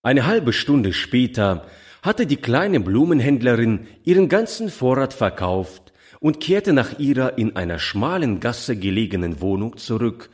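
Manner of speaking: ordinary delivery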